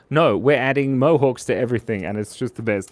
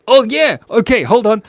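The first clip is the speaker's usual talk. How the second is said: ordinary talk, nothing marked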